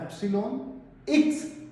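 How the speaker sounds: "एप्सिलॉन एक्स" said slowly and clearly, rising on "एक्स"; pronunciation demo. Shown as a demonstration. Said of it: "इसको हम क्या pronounce करते हैं". ("एप्सिलॉन एक्स" धीरे और साफ़, "एक्स" पर आवाज़ ऊपर)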